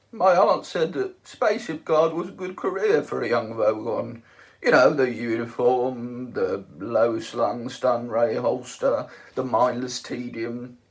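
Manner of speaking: loud, slow, dim guard; lightly voiced Vogon. A narrator's reading. Vogon guard explains his career to Ford, slow and dull. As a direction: plod through it like a brute.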